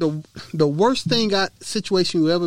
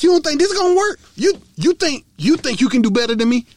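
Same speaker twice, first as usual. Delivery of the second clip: higher, louder mock outrage; mock indignant ex; 7 semitones higher, 5 dB louder, faster than usual. Imitating the ex-girlfriend at the breakup, mock outraged. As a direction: ham up her offended challenge.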